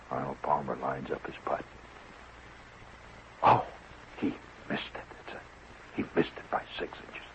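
whispered, reverent golf commentary. Shown as a demonstration. Showing the hushed golf announcer, whispered reverently. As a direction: barely breathe the words. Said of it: "they speak in a hushed voice".

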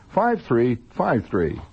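ordinary talk, nothing marked

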